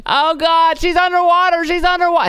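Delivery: high squealing mock old lady; hysterical old lady. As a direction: shriek it like a panicked old woman. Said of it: "That old lady was like".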